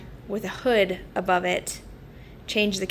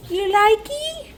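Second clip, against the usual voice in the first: high, loud pet voice; dog voice; 12 semitones higher, 4 dB louder, slower than usual. Real, not acted. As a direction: coo back at the dog. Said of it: "I'm talking in my dog voice".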